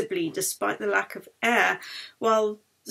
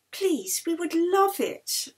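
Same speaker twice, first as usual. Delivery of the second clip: higher, slow pleading; frail plea; 8 semitones higher, slower than usual. Acted. Sick girl begging the matron, higher and slow.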